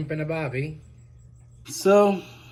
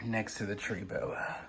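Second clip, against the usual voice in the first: whispering; 4 semitones lower, 11 dB quieter than usual. Real, not acted. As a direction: keep it to a whisper so she isn't startled. Whispering beside the tree boa.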